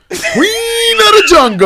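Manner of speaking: high, loud, drawn-out cry; dramatic shout. Acted. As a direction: belt it like a jungle call. Dramatic hype of the outfit.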